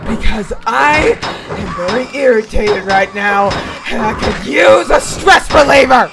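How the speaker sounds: rising to a loud threat; building threat. Real, not acted. Threatening enemies before fight.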